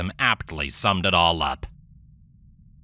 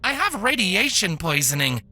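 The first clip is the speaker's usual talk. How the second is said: higher, quick, insistent doctor; brushing the robot off. Acted. Doctor refuses, rising.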